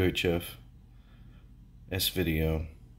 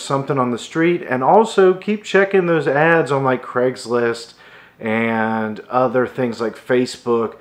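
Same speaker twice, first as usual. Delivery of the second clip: louder, higher, projected wrap-up to viewers; energetic vlog sign-off narration; presenter voice-over; 6 semitones higher, 9 dB louder than usual. Acted. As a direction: deliver a punchy, emphatic video outro.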